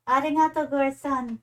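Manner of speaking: ordinary talk, nothing marked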